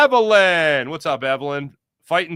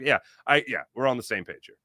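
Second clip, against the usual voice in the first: ordinary talk, nothing marked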